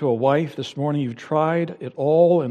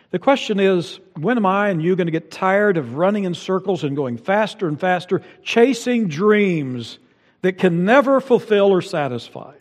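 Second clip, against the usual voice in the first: higher and louder, pushing the question hard; preaching at full force; 5 semitones higher, 4 dB louder than usual. Acted. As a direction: build it like a preacher's challenge. Pastor presses congregation, rising intensity.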